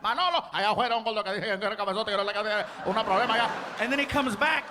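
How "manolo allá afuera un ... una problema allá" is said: rapid grumbling in Spanish; fast trash talk. Retold or imitated. Waiter complaining to the cook, fast. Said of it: "starts talking trash to one of the cooks".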